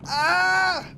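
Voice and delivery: sudden high yelp of fright; startled high scream. Real, not acted. Startled by the sneaking killer, high yelp.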